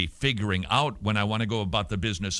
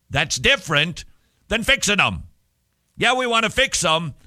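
higher and louder, pressing the point; emphatic build; 8 semitones higher, 5 dB louder than usual. Real, not acted. Preacher to parents, pressing the point hard. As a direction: lean hard into the contrast. Stressing the key contrast.

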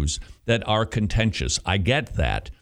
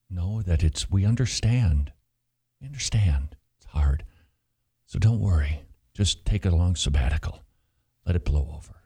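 mock soothing voice; 8 semitones lower than usual. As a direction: lull the pastor into silence, gently. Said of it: "a very loud voice saying".

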